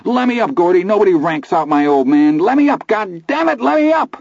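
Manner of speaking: very high sobbing rage; rising into a desperate wail; sobbing plea. Acted. Teddy sobbing and struggling.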